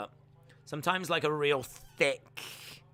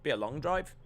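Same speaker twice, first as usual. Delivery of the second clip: normal talking voice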